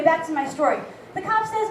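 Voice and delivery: ordinary talk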